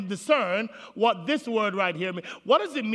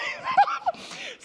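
high shrieking laugh. Real, not acted. Preacher laughs mid-sermon.

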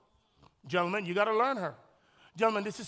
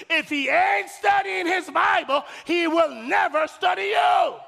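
higher, louder and slow; full-force sermon warning; preaching shout; 6 semitones higher, 5 dB louder, slower than usual. Real, not acted. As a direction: shout each word at the single people. Preacher warns singles.

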